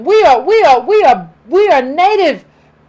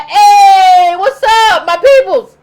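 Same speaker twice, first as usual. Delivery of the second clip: shouted hey, higher and louder, overfriendly; loud excited greeting; 4 semitones higher, 6 dB louder, much slower than usual. Retold or imitated. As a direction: call out to long-lost relatives, hammed-up. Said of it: "be like".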